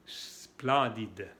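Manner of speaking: "Splendide" starting on a drawn-out hiss; snake-like S. Shown as a demonstration. Teacher drilling learners, long snake S opening "Splendide". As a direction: stretch the first S of "Splendide", no E before it. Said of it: "la S que se pronuncia como la serpiente".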